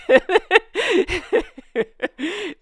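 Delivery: high burst of laughter; big laugh. Real, not acted. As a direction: laugh out loud at the joke. Laughs after the quote.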